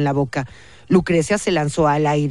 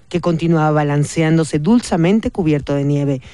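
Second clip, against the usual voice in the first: usual speaking voice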